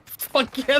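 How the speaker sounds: high, eager burst; gung-ho yell. Retold or imitated. Player answering the DM's doubt, high and eager. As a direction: fire it back with zero doubt. Own character answers in retold game.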